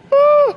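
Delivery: sudden high yelp; startled yelp. Real, not acted. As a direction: yelp in surprise. Sudden yelp mid-sentence.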